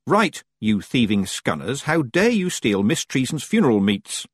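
ordinary delivery

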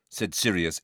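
ordinary delivery

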